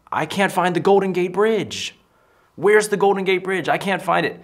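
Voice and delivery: high, loud holler; yelling. Retold or imitated. Woman yelling out the window around San Francisco, loud shout. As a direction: yell it out across the street. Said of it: "she starts yelling out the window".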